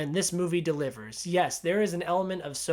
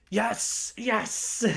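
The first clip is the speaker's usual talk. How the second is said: euphoric cheer; 5 semitones higher than usual. Retold or imitated. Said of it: "such a euphoric scene of".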